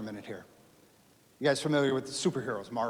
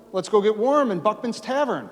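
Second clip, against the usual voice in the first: eager suggestion; 7 semitones higher, 6 dB louder than usual. Retold or imitated. Militia men suggesting the tavern.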